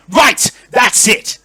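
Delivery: loud, high outburst; indignant lord losing patience; demanding shout. Acted. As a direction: shout it, each word drawn out. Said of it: "demands Lord Verence".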